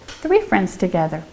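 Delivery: normal talking voice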